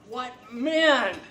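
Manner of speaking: a loud, ringing exclamation; loud, awed outburst; loud cry. Acted. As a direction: cry it out in awe. Overwhelmed by the wounded soldiers.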